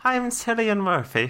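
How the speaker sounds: high, hammed-up Irish lilt; mock Irish accent. Contestant tries an Irish accent, hammed-up lilt. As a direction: badly fake an Irish actor introducing himself. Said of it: "let's hear your best Irish accent".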